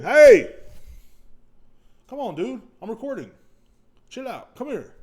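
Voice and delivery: sharp high shout, then calling; dog owner yelling and calling. Real, not acted. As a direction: yell to stop the dog.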